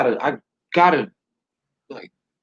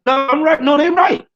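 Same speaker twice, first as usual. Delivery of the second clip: much higher and louder, flipping back and forth; a mock heated back-and-forth, pitched up high; worked-up self-argument; 13 semitones higher, 4 dB louder than usual. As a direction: argue with yourself, getting worked up.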